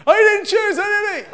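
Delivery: put-on high, loud squeaky child protesting; whiny child protest. Child protests to dad that he never chose, high whiny squeal. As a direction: squeal like a kid who got caught.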